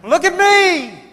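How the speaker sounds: put-on high, loud childish crow of delight; gleeful shout. Little boy riding on the swimmer's back, gleefully shouting. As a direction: show off like a kid on top of the world.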